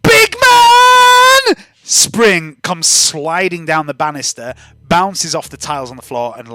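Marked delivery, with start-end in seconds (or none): 0.0-1.6 very high, loud yell from afar; yelled greeting. Acted. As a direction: holler it across the hall. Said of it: "And then there's a yell".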